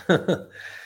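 a high, breathy laugh of admiration; admiring laugh. Real, not acted. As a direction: laugh in delighted disbelief. Admiring laugh after father's story.

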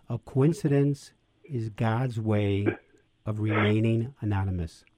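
slow, each word set apart; slow, weighty delivery. Shown as a demonstration. Priest giving the guest a treasured saying, slow and deliberate. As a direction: lay the definition down slowly, letting it land. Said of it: "here's the best definition of coincidence I ever heard".

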